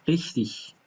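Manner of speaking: ریختیک with a hard ik at the end; ریختیک, the ending clipped to ik; regional German ending. Shown as a demonstration. Teacher gives a dialect example of a common word. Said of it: "به صورت ایک شما اینو بشنوید".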